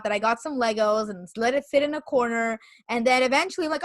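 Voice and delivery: ordinary talk, nothing marked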